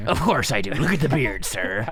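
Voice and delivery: swaggering pirate captain. Pretend. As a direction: boast like a proud pirate. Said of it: "do you have a pirate voice?".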